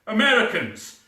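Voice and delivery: ordinary talk, nothing marked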